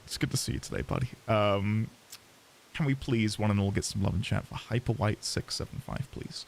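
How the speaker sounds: soft, low shoutout; quiet. Real, not acted.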